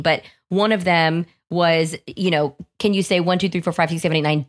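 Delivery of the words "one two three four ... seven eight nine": numbers rattled off quickly; rapid-fire count. Shown as a demonstration. MC contest counting challenge shown to listeners, rapid-fire. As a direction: race through the count without a gap. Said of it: "as fast as you can".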